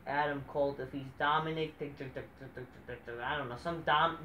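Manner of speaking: usual speaking voice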